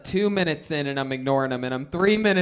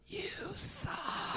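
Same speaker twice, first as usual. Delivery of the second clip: unvoiced mocking whisper; 14 dB quieter than usual. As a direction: hiss it quietly from the front row. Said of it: "just whispering".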